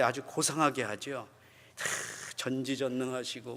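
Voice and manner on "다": usual speaking voice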